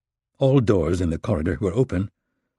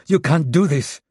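protest; 8 semitones higher, 4 dB louder than usual. Acted. Raisa protests at gunpoint.

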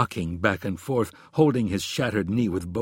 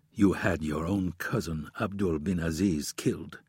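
low, quiet, cold killer voice; calm menace, very quiet; cold assassin; 5 semitones lower, 6 dB quieter than usual. A narrator's reading. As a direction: assassin speaks to his victim, quietly.

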